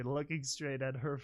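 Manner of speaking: usual speaking voice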